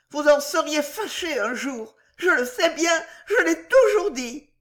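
high-pitched maid's lamenting reproach; tearful little tragedy. A narrator's reading. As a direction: warn the husband, building to a wail. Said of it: "monta de son côté une petite tragédie". (remontrance larmoyante et aiguë de servante)

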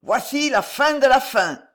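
defiant, jubilant call; exultant last cry. A narrator's reading. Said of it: "dans un suprême éclat de rire".

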